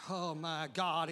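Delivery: ordinary talk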